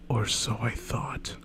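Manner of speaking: hushed, slow dramatic whisper; ominous whisper. Acted. Reading a fanfic cliffhanger aloud, in a slow whisper. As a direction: whisper it like a horror twist.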